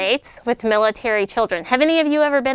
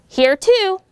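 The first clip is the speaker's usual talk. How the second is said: put-on high chirpy kid voice; a schoolkid calling out present; child answering roll; 10 semitones higher, 5 dB louder than usual. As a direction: pipe up like an eager child.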